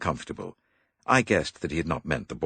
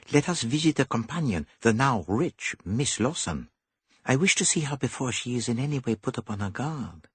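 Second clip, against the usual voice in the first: higher, fussy little detective; higher dapper detective; Poirot voice; 9 semitones higher than usual. A narrator's reading. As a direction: play the clever Belgian.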